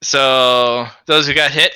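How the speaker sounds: ordinary talk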